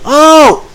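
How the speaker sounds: a high, loud yelp; shrill yelp. Real, not acted. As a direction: let out a sharp cry. Sudden yelp after the shots.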